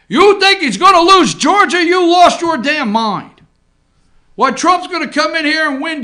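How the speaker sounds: loud, high incredulous jab; scoffing rant. Real, not acted. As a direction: scoff at the doubters. Ranting rebuttal.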